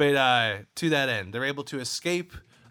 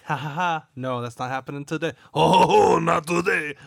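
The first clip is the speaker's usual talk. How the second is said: mocking gloat; 4 semitones higher, 9 dB louder than usual. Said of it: "they were able to be like".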